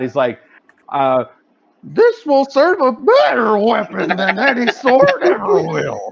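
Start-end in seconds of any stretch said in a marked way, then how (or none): 1.8-6.1 horrid high, loud cracked declaiming; failed commanding voice. Acted. Hero fails miserably at impressing the dragon, horrid high voice. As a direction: botch a heroic boast in a high, horrid voice. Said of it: "fails miserably".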